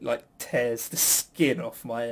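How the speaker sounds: ordinary talk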